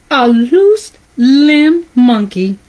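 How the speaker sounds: usual speaking voice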